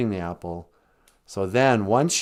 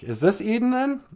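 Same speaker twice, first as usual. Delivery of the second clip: higher-pitched questioning voice; puzzled Adam; 13 semitones higher than usual. Acted. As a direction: act a puzzled man asking. Adam asks about his wife.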